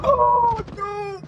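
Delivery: high shrieking oh no; panicked. Real, not acted. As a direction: cry out in alarm. Danger in game.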